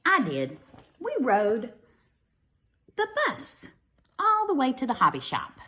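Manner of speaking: lively storybook read-aloud; children's story voice for young listeners. A narrator's reading. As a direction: read it brightly to a class of kids. Reads picture book to children.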